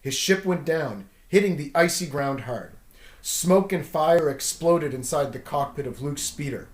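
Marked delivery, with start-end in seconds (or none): none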